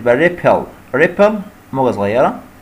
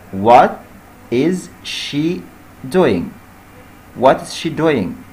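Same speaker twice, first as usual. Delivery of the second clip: each word drawn out slowly; slow dictation; much slower than usual. Shown as a demonstration. Teacher dictating the question slowly to students. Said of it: "ماذا هي تفعل؟".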